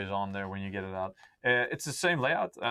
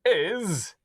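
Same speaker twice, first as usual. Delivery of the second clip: announcer voice; 8 semitones higher, 4 dB louder, much slower than usual. Pretend. Starts the announcement.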